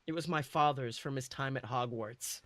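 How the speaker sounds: usual speaking voice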